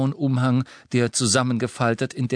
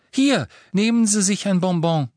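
high, pushy and loud; insistent; 10 semitones higher, 5 dB louder than usual. A narrator's reading. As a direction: press the sweet on him. Hermione pushing a sweet on him.

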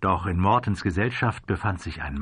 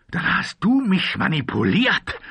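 high, loud, indignant question; incredulous raised voice; outraged accusation; 10 semitones higher, 5 dB louder than usual. A narrator's reading. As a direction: burst out, feeling used. Gunslinger realizes he was manipulated.

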